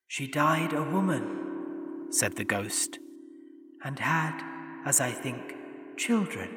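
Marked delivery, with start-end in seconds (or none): 0.1-2.1 lightly higher, soft spectral voice; a gentle ghost, hushed and airy. A narrator's reading. Ghost remembering Scrooge's sister, soft and otherworldly. 3.8-6.5 a gentle ghost, hushed and airy. A narrator's reading. Ghost remembering Scrooge's sister, soft and otherworldly.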